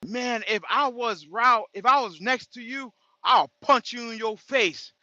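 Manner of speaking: mocking a hothead's threat; quoting angry commenter. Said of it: "This guy was like".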